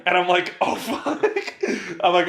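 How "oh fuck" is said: high-pitched, half-laughing mock alarm; comic oh-no, pitched up; laughing mock dread. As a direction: let a laugh break into the mock dismay. Acted dread at spicy ramen offer.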